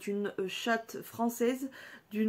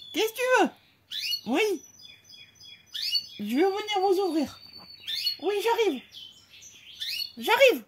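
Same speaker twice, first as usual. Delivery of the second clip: much higher, louder sing-song call; 9 semitones higher, 6 dB louder than usual. Real, not acted. Owner calling back to her pets, high and loud. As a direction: answer the pets from across the room.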